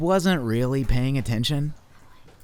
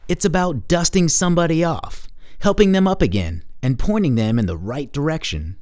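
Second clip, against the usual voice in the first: plain reading voice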